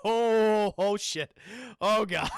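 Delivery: loud, drawn-out "oh" of mock dread; acted dismay. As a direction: groan it loudly in comic alarm. Character reacts to the brain.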